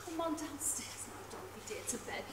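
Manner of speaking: hushed, breathy coax; coaxing whisper. Acted. Wife coaxing her drunk husband to bed, hushed whisper. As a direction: whisper him gently off to bed.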